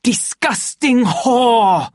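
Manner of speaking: high, loud, drawn-out curse; disgusted rage. A narrator's reading. Savage pacing alone in anger, slow loathing shout. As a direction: let revulsion swell into a roar.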